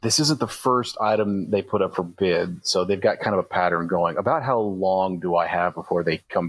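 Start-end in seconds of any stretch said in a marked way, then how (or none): none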